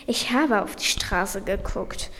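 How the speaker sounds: mildly irritated reply; a touch of exasperation, lightly voiced; annoyed. A narrator's reading. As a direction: read it as a lightly annoyed defendant. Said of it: "Cedric antwortete genervt".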